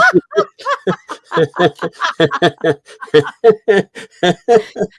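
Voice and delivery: big high-pitched burst of laughter; loud high laugh. Real, not acted. Cohost cracking up at her own wings joke, loud high laugh.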